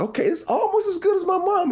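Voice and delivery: squeaky high falsetto praise; high mock verdict. Retelling his verdict on his wife's casserole, high falsetto. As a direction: pitch it way up like a judge tasting.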